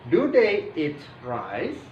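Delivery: slow question, THEY lifted, RICE rising at the end; intonasi bertanya. Shown as a demonstration. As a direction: demonstrate question tune slowly, ending up on "rice?". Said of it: "intonasinya seperti intonasi bertanya". (nada bertanya, pelan, "rice?" naik)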